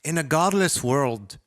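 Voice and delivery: ordinary delivery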